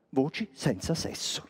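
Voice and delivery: soft, secretive naming; hushed reveal. Acted. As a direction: lean in and let it fall softly. Reveals the name in a hush.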